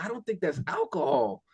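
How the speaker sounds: teen son doubting the dad's excuse, mock sceptical; sceptical aside. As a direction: drop it quietly, like a wry realisation. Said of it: "And I'm like".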